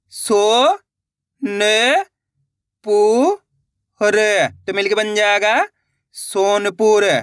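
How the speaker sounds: slow, each syllable said on its own with pauses. Shown as a demonstration. Teacher sounding out words for young readers, slow and syllabic. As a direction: spell it out for beginners, then blend the word. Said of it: "आ रहा है दोस्तों समझ में किस तरीके से".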